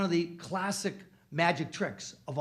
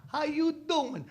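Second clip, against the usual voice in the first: Italian-American greeting; 11 semitones higher, slower than usual. Acted. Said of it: "That's how we greet each other".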